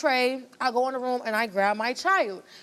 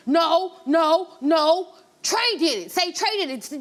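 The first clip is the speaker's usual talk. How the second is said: insistent retold ex; 4 semitones higher, 4 dB louder, slower than usual. Ex insisting on blaming Trey.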